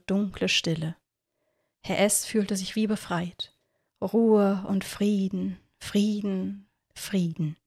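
slow, softening into silence; peaceful calm. A narrator's reading. Narrator easing Herr S into peaceful sleep, slow and fading.

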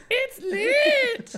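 very high, loud doll squeal; Heaven. Retold or imitated. Host imitates friend hyping things up, squealed. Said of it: "she's gonna be like".

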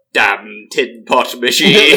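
slow, laughing grumble; muttered with a laugh; grumbling quote. Retold or imitated. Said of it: "what does he call it?".

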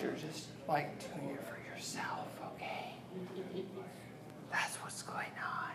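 hushed stage whisper. Real, not acted. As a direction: drop to a whisper to the crowd. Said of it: "I'm gonna whisper now".

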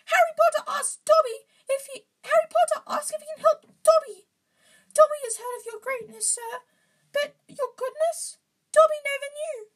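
tearfully grateful, very high loud elf; Dobby. A narrator's reading. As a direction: wail with gratitude in falsetto. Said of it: "into wails of gratitude".

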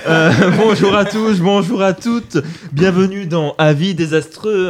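upbeat show opening; podcast host intro. Acted. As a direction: greet the listeners like a host.

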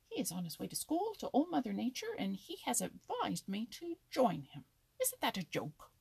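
indignant ridicule. A narrator's reading. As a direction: scoff at the very idea. Indignant squirrel ridicules the school invitation.

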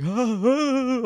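high, loud fake choking; mock choking gasps. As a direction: gasp as if being strangled. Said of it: "he's like".

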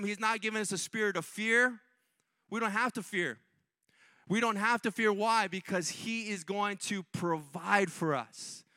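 rhythmic, repeated rises that fall away; emphatic preaching. Real, not acted. Preacher assuring believers there is no lack, emphatic preaching. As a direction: drive each declaration home to the crowd.

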